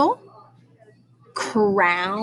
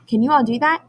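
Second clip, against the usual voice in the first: ordinary delivery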